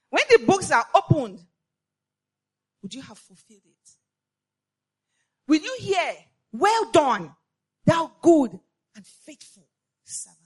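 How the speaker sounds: loud, ringing preaching; full-force preaching. Acted. Preacher warning her sisters of judgment, loud preaching. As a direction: proclaim it to the whole church.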